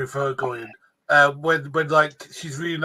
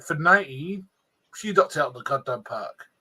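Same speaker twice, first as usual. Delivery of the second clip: ordinary delivery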